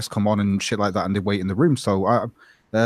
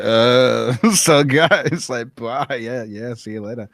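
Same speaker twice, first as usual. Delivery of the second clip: lightly imitating a drunk streamer; wasted; 3 semitones lower, much slower than usual. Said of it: "He's a bit wasted".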